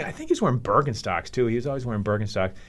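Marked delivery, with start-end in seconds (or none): none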